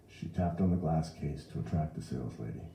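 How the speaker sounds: ordinary delivery, nothing marked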